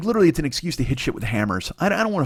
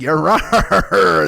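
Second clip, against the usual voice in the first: loud pirate "Yar!"; movie ants roaring "Yar!"; ant pirate yell; 3 semitones higher, 6 dB louder than usual. Retold or imitated. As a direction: shout it like a giant ant pirate. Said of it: "walking around like".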